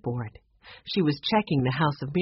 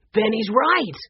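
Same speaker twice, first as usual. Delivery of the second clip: higher, louder call; 5 semitones higher, 4 dB louder than usual. A narrator's reading. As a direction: call it out to the others. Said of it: "he called out".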